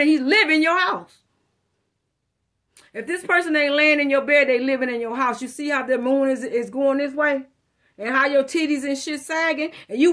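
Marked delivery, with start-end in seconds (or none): none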